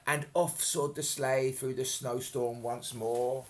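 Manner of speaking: ordinary delivery, nothing marked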